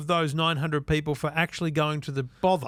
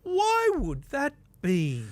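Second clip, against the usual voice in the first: high, drawn-out feigned puzzlement; mock wondering; 10 semitones higher, much slower than usual. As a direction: play dumb in a high, slow singsong. Feigned wondering about an obvious cause.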